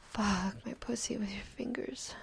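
ordinary talk, nothing marked

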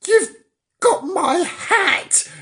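outraged at the stolen hat; furious old man's slow cry; in a rage. Acted. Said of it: "he said in a rage".

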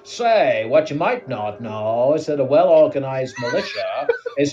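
lightly hammed-up American host accent; a hint of a cheesy American presenter. Reading Sam fake constitution facts, light American accent. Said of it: "we're doing accents again".

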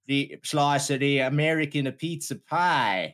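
put-on very slow, laboured American accent. Sitcom flatmates discussing pizza, hammed-up slow American accent. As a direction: stretch the words out in a fake American accent. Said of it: "fifty percent engaged in the accent".

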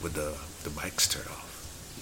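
hushed conspiratorial whisper. Real, not acted. Cohost teasing about later, in a whisper. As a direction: drop to a secret whisper.

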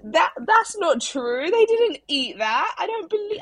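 high-pitched, stammered protest; amused disbelief. Real, not acted. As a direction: refuse to believe the nursery's claim. Disputes the nursery's food report.

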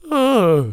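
high, loud "oh" noise; small mock celebration. Co-host mimics his puberty celebration, high loud exclamation. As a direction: let out a high comic "oh". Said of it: "More of a".

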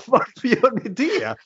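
baffled, half laughing at the absurd; disbelieving question, slow and high; incredulous. Real, not acted. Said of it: "incredulous at posing heroes".

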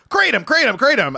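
Mike Figs catchphrase. Pretend. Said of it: "to be Figs".

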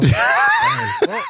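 very high falsetto squeak; squeaky girl voice. Pretend. Girl called Barry introducing herself, falsetto squeak. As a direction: squeak the name like a little girl.

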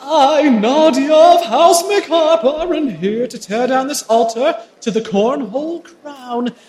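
loud, high, drawn-out proclamation; grandiose villain reveal. Acted. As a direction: proclaim it like an avenging noble. Nadia's dramatic reveal on stage.

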